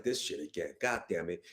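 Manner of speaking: low, quiet grumbling under his breath; muttered annoyance. Retold or imitated. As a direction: mumble it to yourself, fed up. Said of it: "I was like".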